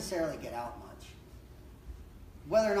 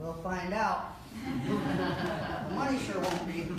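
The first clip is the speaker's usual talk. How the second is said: laughing. Real, not acted. Joking about money not lasting.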